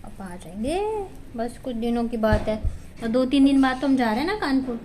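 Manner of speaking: soft, hushed consoling words; gentle comforting. Real, not acted. Mother to daughter missing her papa, softly consoling. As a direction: coax her out of crying, gently.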